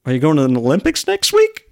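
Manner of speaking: quick, high rhetorical tease; teasing question. Retold or imitated. Coach's teasing question, retold.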